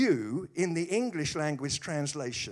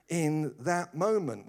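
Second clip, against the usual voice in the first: ordinary talk, nothing marked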